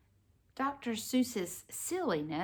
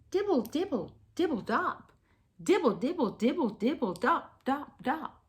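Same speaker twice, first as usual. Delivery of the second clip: rain sounds; 7 semitones higher than usual. Pretend. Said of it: "He can sound like the rain".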